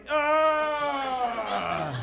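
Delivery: quiet, drained ugh; a spent, quiet groan; weary groan. Retold or imitated. Exhausted dad answering the sick kids with a quiet groan.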